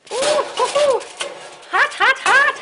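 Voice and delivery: high yelps at a hot pan. Real, not acted. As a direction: squeal at the burning heat. Hot pan going into oven.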